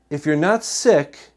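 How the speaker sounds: normal talking voice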